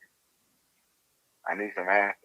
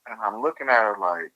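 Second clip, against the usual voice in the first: usual speaking voice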